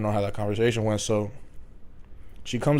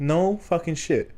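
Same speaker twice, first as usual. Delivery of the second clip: drawn-out, higher-pitched sarcastic comeback; retold deadpan-obvious reply, stretched slowly and pitched up; sarcastic retort; 6 semitones higher, slower than usual. New hire retelling his reply to a needless tip, sarcastic.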